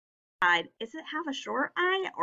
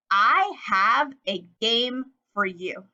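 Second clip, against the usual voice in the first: word by word, measured and slow; slow model sentence; slower than usual. Shown as a demonstration. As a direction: say it slowly for children to copy. Repeats target sentence for pupils.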